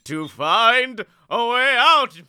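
high, slow hammed-up menace; overplayed spooky host line; slow theatrical villain menace. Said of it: "I'll take ghost host".

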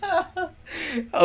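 laughing while speaking. Real, not acted. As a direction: laugh through the words. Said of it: "took me by surprise".

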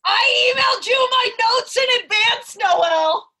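mock indignant protest. Mock indignant defence to cohost.

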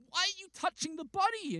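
high-pitched mock alarm; appalled high reproach; exasperated scolding. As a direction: squeal the question in disbelief. Detective scolds janitor.

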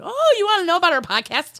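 very high, loud excited pitch; high, loud gushing pitch to a curious stranger; mock eager. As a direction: burst out eager to promote the podcast.

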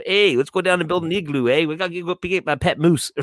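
high, hammed-up Canadian holler; yelled Canadianisms. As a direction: yell like a tipsy caricature Canadian in the background. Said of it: "I'm just yelling the most Canadian things".

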